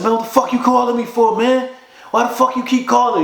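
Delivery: high-pitched, quick angry retort down the phone; retold angry outburst. Friend in prison bond answering a call, retold angry. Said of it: "He sound like that".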